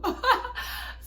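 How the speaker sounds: high breathy laugh; laughing at her own story; burst of laughter. Real, not acted. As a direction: crack up laughing.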